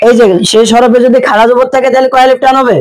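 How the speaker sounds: ordinary delivery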